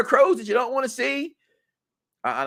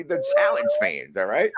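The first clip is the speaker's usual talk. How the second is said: ordinary delivery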